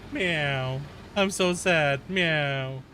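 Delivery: lightly put-on high kitty voice, pleading; voicing the cat. Speaking for the sad cat, light high pleading voice. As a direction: play the lonely cat asking for love.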